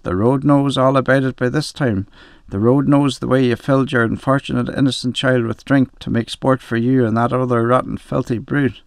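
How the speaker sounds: ordinary delivery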